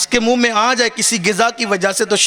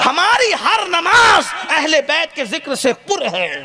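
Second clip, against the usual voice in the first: proclaiming; 7 semitones higher, slower than usual. Real, not acted. Preacher proclaims the opening line.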